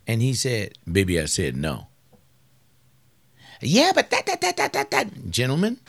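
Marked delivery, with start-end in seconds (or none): 0.9-1.9 a lower, firmer husband's no. Retold or imitated. Preacher voicing husband refusing his wife, lower and firm. 3.6-5.3 much higher, stammering wife's protest; sputtering wife. Retold or imitated. Preacher mimicking wife stammering back at husband, high and flustered.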